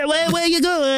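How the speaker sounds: put-on high, halting stab at a Greek accent; badly faked Greek accent. Imitating the Greek lady who stopped, badly faked Greek accent. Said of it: "I can't do a Greek accent".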